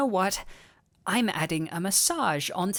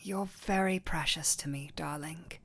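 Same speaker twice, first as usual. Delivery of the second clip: soft breathy whisper of love; tender whisper; 4 semitones lower, 5 dB quieter than usual. Acted. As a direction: whisper it close to their ear. Tender whispered confession to partner.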